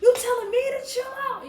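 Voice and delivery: high, loud outraged squeal; retold outraged yelling. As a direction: shriek it back at him in mock fury. Retold furious comeback at boyfriend.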